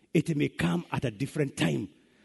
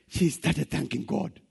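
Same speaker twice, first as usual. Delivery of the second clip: usual speaking voice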